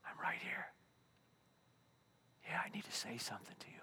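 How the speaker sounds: breathless whisper of God. Acted. Pastor voicing God speaking in quiet time, whispering. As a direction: whisper it close, like a secret. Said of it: "and God goes".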